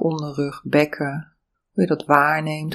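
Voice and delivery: normal talking voice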